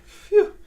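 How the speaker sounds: a high, loud exhale of relief; loud phew. Real, not acted. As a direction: blow it out, glad it's done. Relief while building the set.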